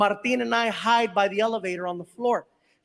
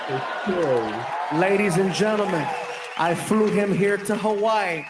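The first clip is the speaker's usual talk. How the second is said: announcer intro; 6 semitones lower than usual. Real, not acted. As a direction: hype the guest onto the stage. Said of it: "comic announces guest on stage".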